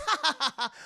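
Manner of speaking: high, loud, put-on ha-ha laugh; mocking laugh. Friend covering his tears at the dangling hero, high mock laugh. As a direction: laugh it off heartily and falsely.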